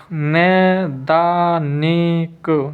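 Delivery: नैदानिक very slowly, नै-दा-नि-क; slow, drawn-out नैदानिक for writing down; syllable-by-syllable dictation. Shown as a demonstration. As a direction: stretch every syllable of नैदानिक. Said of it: "नई दानिक कैसे लिखेंगे".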